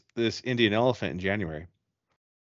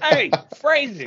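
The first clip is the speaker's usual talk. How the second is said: high callout; 6 dB louder than usual. Pretend. Mock soundboard callout.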